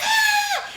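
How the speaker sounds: very high, thin mock scream; mock startled shriek; imitated frightened scream. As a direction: squeal in pretend fright without full volume. Grandma screams at the scare.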